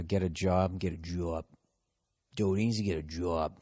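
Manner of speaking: low, soft Boston accent. Shown as a demonstration. Host showing how Boston says it, Boston accent. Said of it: "the Boston accent kinda came back".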